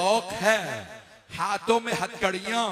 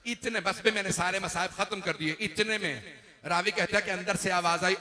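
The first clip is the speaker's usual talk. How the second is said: ordinary delivery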